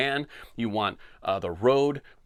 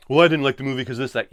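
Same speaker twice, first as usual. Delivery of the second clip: mock commenter; 6 dB louder, faster than usual. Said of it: "I can hear the comments already".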